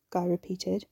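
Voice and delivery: plain reading voice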